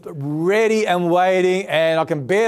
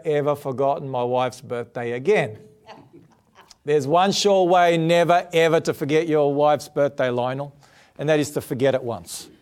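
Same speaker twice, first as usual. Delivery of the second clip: usual speaking voice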